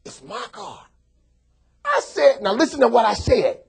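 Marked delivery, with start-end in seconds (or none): 0.0-0.8 Jimmy's voice. Retold or imitated. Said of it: "He said".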